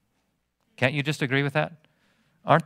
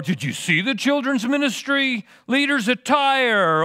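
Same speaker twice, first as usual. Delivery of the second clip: higher scandalized gossip voice; mock gossip; 9 semitones higher than usual. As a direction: play the nosy church gossip. Mocking petty churchgoer gossip.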